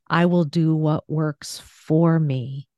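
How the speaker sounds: slow phrase, punching "for"; stress on FOR. Shown as a demonstration. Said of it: "let me, uh, do one of these".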